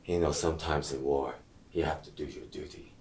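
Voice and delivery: low, quiet cruel taunt; quiet menace. Acted. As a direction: savor it quietly. Villain's cold cruel taunt.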